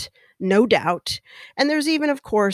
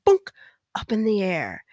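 usual speaking voice

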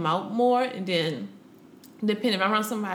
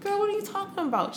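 high and fast in disbelief; incredulous; 12 semitones higher, 3 dB quieter, faster than usual. Retold or imitated. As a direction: toss it back stunned. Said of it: "I'm like".

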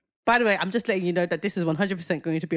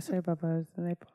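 quiet; 4 semitones lower, 6 dB quieter, faster than usual. Retold or imitated. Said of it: "I was like".

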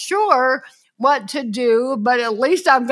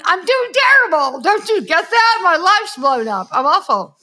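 mock outburst; 6 semitones higher, 4 dB louder than usual. Voicing a grieving person's answer.

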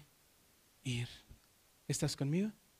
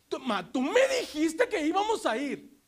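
put-on higher, insistent kid voice; a child holding mom to her word; child complaining; 9 semitones higher, 5 dB louder than usual. Child reminding mother of promise. (niño reclamando a su mamá, agudo)

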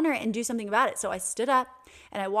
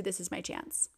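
much softer, almost to herself; soft realization; 2 semitones lower, 11 dB quieter than usual. Retold or imitated. To herself in the exam room, soft dawning resolve. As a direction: let the thought land quietly. Inner thought, shifting her mindset.

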